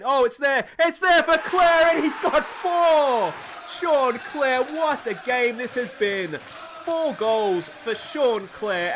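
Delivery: excited goal call. Real, not acted. Hailing Clare's fourth goal to viewers, soaring slow call. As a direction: let the fourth goal ring out, drawn out.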